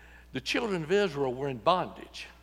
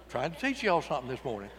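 ordinary talk, nothing marked

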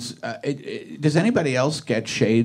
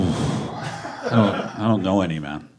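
usual speaking voice

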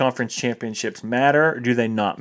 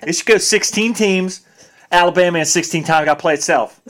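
raised-pitch, emphatic Southern fan rant; mock SEC fan; 6 semitones higher, 3 dB louder than usual. Mocking SEC bias to cohost, hammed-up fan rant. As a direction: rant like a die-hard Alabama fan.

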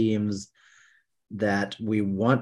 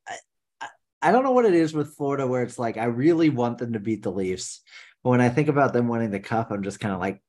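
ordinary talk

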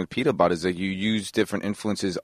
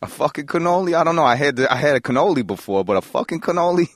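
higher-pitched, indignant disbelief; playfully baffled, pitched up; mock incredulous; 5 semitones higher than usual. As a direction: question it like an absurd offer. [name] improv, offered a cannoli.

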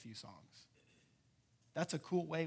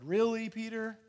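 mock eye-rolling incredulity, pitched up; exasperated disbelief; 6 semitones higher, 8 dB louder than usual. Jesus teases sinking Peter, mock exasperated. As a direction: draw it out like an eye roll. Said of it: "rolled his eyes and said".

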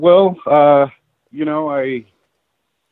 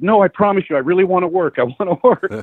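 higher, earnest pleading for work; retold plea, pitched up and insistent; begging; 7 semitones higher than usual. Photographer begging the editor for any assignment, pleading high. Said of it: "I'm, like, begging this woman".

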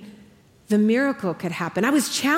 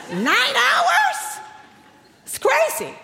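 much higher, louder shocked "Nine hours?"; incredulous disbelief; 4 dB louder, much slower than usual. Acted. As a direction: squeal it like you can't believe it. Incredulous at husband's golf chat.

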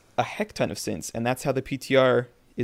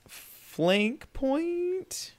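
doubtful; 5 dB quieter than usual. Real, not acted. Doubtful pause over the term.

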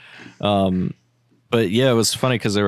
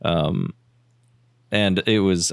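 ordinary talk

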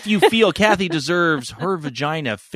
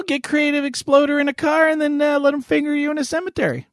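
raised pitch, teasing; squeaky playful needling; high mock voice; 14 semitones higher, faster than usual.

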